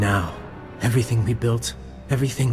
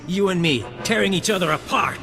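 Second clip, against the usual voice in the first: high-pitched, worked-up taunt; fierce relish; 6 semitones higher than usual. Acted. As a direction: relish the coming fight, pitch climbing. Villain relishes the final fight.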